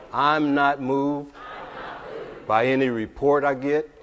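forceful, drawn-out faith declaration; preach. Real, not acted. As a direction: proclaim each phrase loud and slow, with long pauses.

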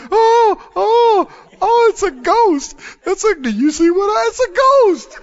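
high panicky cries of ghost; hammed-up fright, voice jumping up; terrified fishermen. Burly fishermen seeing a ghost on the water, hammed-up panic.

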